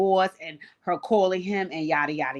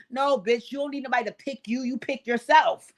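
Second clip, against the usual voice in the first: ordinary delivery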